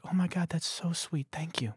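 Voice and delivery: mock thankful woman. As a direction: voice her gently touched thanks. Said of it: "she's like".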